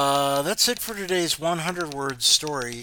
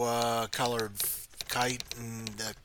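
plain reading voice

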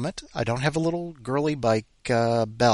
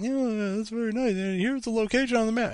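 much higher brushing-off voice; mocking dismissive rep; 12 semitones higher than usual. MetLife rep brushing him off, high mocking voice. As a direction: rattle it off dismissively. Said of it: "they're like".

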